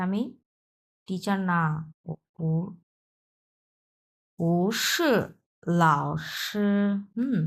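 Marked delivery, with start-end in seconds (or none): 2.1-6.7 slow model reading of ও পু পু সে লাও সে with clear syllables; careful Chinese reading. Shown as a demonstration. Teacher asks students to pronounce beautifully, careful Mandarin model. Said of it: "সুন্দরভাবে উচ্চারণ করবেন".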